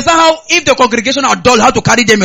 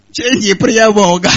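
drawn out at half speed, imitating a tired congregation; mock weary crowd, very slow; voice going down; 2 semitones lower, much slower than usual. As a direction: drag it out slowly, mimicking flagging pray-ers. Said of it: "their voice will go down".

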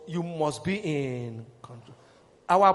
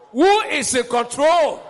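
loud slow call; 6 semitones higher, 10 dB louder, slower than usual. Shown as a demonstration.

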